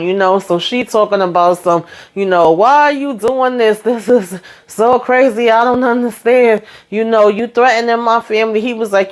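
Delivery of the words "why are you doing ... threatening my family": higher-pitched, plaintive imitation; mock distressed pleading. Lily asks husband why he is threatening her family, mock distressed pleading. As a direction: plead in a put-on upset voice.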